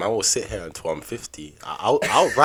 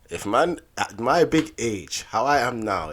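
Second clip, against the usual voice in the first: normal talking voice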